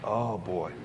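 ordinary delivery

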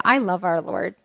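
normal talking voice

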